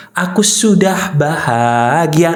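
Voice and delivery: drawn-out demonstration statement; model affirmation. Shown as a demonstration. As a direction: stretch the words out deliberately. Demonstrating the right self-statement.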